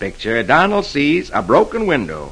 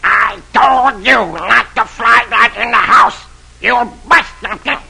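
scolding; 8 semitones higher, 3 dB louder than usual. Acted. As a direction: scold it like a cross uncle. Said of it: "Donald scolds Dewey".

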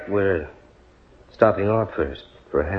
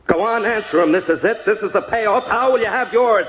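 high, urgent demand; mounting high-pitched pressure; insistent pressing; 11 semitones higher than usual. Acted. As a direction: badger a cornered customer for an answer. Pressuring her for fatal answer.

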